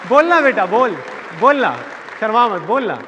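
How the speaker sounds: high, loud, punchy prompting; mock a relative badgering a child to speak; insistent urging. Relatives pushing shy child to answer, loud high urging.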